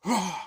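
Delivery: high, loud imitated war cry; imitated roar. Nicolas Cage's character attacking the man, roaring. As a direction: roar as you lunge in. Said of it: "goes like".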